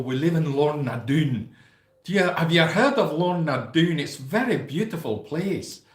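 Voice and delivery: a playful hint of a Scottish accent; mock Scottish voice. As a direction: chat like a Scottish local in a hammed-up accent. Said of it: "I automatically go".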